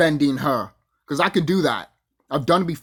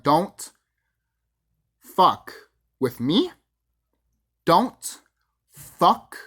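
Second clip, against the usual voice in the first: deliberate, word by word with long pauses; slow threat, each word landed separately; retold menacing warning; 3 dB louder, slower than usual. Intruder warning the executive to leave YouTubers alone, menacing warning.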